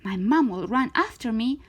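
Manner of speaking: plain reading voice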